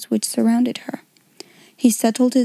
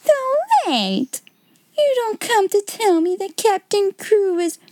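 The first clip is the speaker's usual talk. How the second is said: much higher, frightened disbelief; horrified gasp; 11 semitones higher, slower than usual. Acted. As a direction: dread cutting off her own question. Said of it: "stopped him with a gasp".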